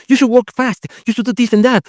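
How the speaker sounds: high-pitched voice of the panicky ego; nagging ego voice. Retold or imitated. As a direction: voice the ego hurrying you. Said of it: "the ego, which is going".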